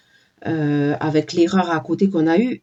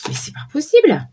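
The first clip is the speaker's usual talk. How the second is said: surprised; 7 semitones higher than usual. Real, not acted. Exclaiming at the cards drawn.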